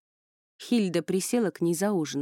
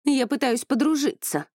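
deliberately loud; 7 semitones higher, 4 dB louder than usual. A narrator's reading. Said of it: "нарочито громко".

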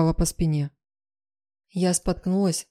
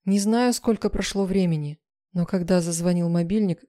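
usual speaking voice

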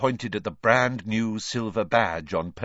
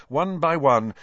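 ordinary delivery

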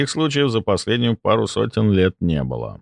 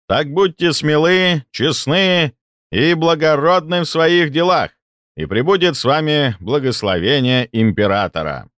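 booming speech; 8 semitones higher than usual. Acted. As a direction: proclaim it to the crowd. Viceroy's speech through microphones.

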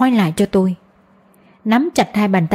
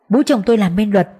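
plain reading voice

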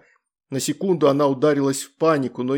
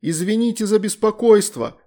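shouting; 8 semitones higher, 3 dB louder than usual. A narrator's reading. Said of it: "крикнула Лакджа".

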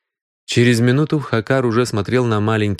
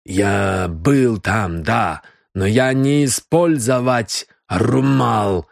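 put-on slow Indian-accented broken speech; slow, careful Indian-accented pleading; much slower than usual. Indian suspect in broken Russian.

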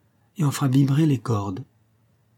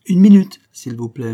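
sharp interruption; 2 semitones higher, 11 dB louder than usual. A narrator's reading. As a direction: cut in loudly before he starts reading. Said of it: "interrompit mademoiselle".

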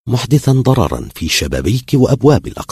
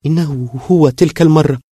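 high, louder cry of alarm; frightened; 10 semitones higher, 5 dB louder than usual. A narrator's reading. Girl clutches young man, terrified. (بفزع وصوت عالٍ)

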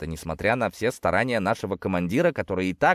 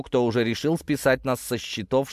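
plain reading voice

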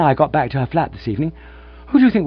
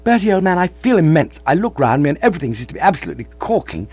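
elated rush; 7 semitones higher, 6 dB louder than usual. Acted. Gussie bursting with happy news.

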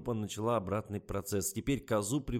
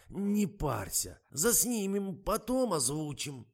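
high, slow soothing singsong; tender cooing; 8 semitones higher, slower than usual. A narrator's reading. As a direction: coax him out of his anger. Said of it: "ласково прожурчал Миша".

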